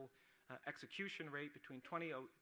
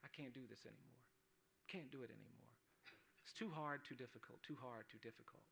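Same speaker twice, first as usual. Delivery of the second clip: despairing inner voice; 8 dB quieter than usual. Retold or imitated. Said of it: "I had this moment where I said".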